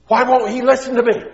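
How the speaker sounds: big sigh, then high, loud wail; sigh, then wail in frustration; exasperated mom. Acted.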